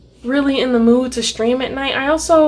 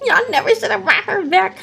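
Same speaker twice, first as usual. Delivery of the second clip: laughing spite; 7 semitones higher than usual. Real, not acted. Spiteful joking rant.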